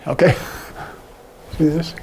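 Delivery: normal talking voice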